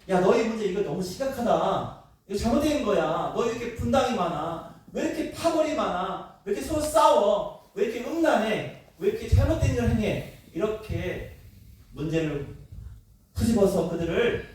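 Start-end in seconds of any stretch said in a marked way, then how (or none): 0.1-10.3 mock scolding. Imagined scolding of Corinthian church.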